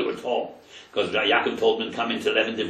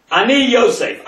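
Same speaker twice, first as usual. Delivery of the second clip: higher and louder, ringing out; dramatic proclamation; 11 semitones higher, 9 dB louder than usual. Acted. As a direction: declare his name to stunned brothers. Yosef reveals himself dramatically.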